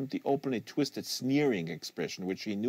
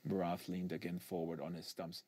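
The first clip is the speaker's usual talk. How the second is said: plain reading voice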